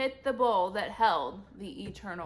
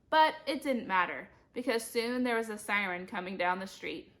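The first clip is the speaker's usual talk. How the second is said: ordinary delivery, nothing marked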